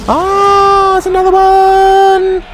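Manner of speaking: very high, slow, squeaky mock voice; high mock squeal. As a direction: squeal it out like a cartoon character. Reacts to odd player, squealing.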